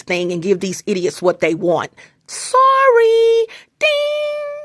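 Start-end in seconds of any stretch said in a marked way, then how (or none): 2.3-4.5 high mock squeal. Mock sound effect outburst.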